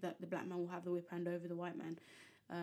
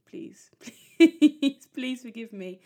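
helpless laugh mid-plea, high and loud; 4 semitones higher, 15 dB louder, much slower than usual. Real, not acted. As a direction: beg while breaking into laughter. Laughing at own bad accent.

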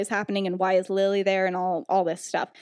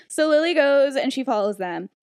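usual speaking voice

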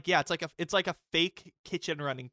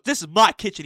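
louder mock protest; retold shout; 5 semitones higher, 13 dB louder than usual. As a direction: claim the kitchen at full volume. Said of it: "And he goes".

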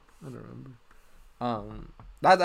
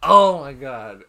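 amazed; 9 dB louder, much slower than usual. Real, not acted. Amazed at the big total.

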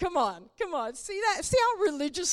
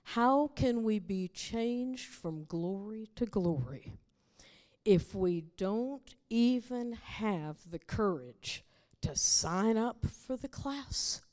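slow, softer earnest question; a quiet, earnest preacher's plea; solemn appeal; 6 semitones lower, 3 dB quieter, slower than usual. Real, not acted. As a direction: ask it gently, pleading.

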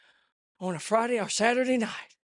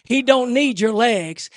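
ordinary talk

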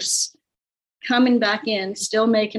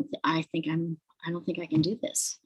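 much softer, honest and drained; quiet, defeated; 3 semitones lower, 8 dB quieter than usual. Retold or imitated. Telling coach Connie she can't do it, soft and defeated. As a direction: admit it barely above a murmur.

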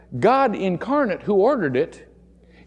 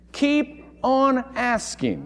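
slow repeat; 6 semitones higher, much slower than usual. Shown as a demonstration. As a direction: let "Keep on asking" sink in slowly. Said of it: "A better translation would be".

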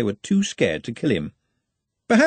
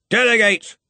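loud, high, curt command; shouted order; 11 semitones higher, 5 dB louder than usual. A narrator's reading. Departing boss answers Ponder's protest, shouting. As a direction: fire it back over your shoulder.

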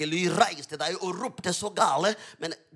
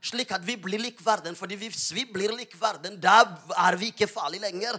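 urgent sermon swelling to loud, higher declarations; fervent preaching, building; 7 semitones higher, 9 dB louder than usual. Real, not acted. Preacher urging believers to speak out, fervent preaching building in force. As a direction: build relentlessly into bold proclamation.

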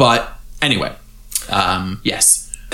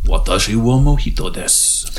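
mock Japanese; 3 semitones lower than usual. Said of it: "It's almost Japanese".

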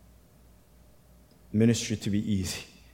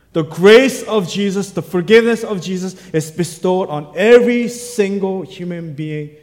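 rising, louder and higher, preaching hard; preaching at full force; 9 semitones higher, 12 dB louder than usual. Acted. Pastor pressing the congregation to decide, preaching full force. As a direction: drive the choice home from the pulpit.

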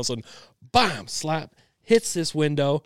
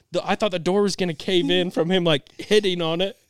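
normal talking voice

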